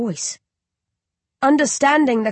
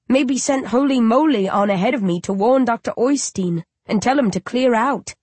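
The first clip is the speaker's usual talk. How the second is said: plain reading voice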